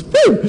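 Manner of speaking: very high surprised yelp; high startled whoop. Retold or imitated. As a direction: yelp as the cold speculum goes in. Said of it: "The patient goes".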